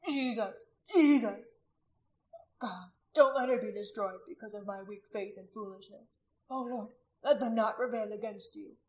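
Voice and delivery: tearful, desperate cry to Jesus; weeping prayer. A narrator's reading. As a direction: beg through tears for her life. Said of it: "He wept".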